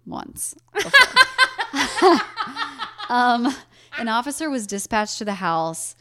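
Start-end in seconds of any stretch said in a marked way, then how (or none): 1.0-3.0 high, loud burst of laughter. Real, not acted. Host laughing after admitting goosebumps.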